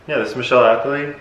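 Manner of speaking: ordinary talk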